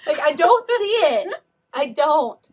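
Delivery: loud, high, laughing disbelief; laughing protest. Real, not acted. Skeptic about friends' ghost photos, loud laughing protest. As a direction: protest loudly through laughter.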